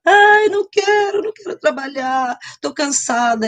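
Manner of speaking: high, whimpering plea; whiny crying. Retold or imitated. Daughter whining to her mother, tearful. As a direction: whine like a weepy daughter. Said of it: "calma, não precisa chorar".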